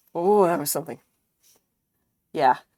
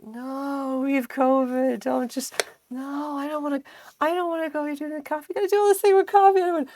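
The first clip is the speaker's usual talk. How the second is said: whining body; 8 semitones higher, 4 dB louder than usual. Acted. Sick body protests to the brain about coffee, high whining. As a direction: plead feebly in a high voice. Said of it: "my body would be like".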